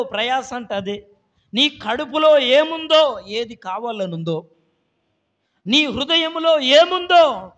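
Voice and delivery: rising revival preacher's cry; preaching. Acted. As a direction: preach it out with full fire. Preaching at full force.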